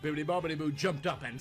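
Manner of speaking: plain reading voice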